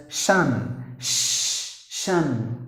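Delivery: shun with a hushing, unbuzzed sh; voiceless sh. Shown as a demonstration. Teacher showing the wrong sound, shun with no vibration. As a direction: isolate Sh, then say shun. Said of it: "You will not feel any vibration".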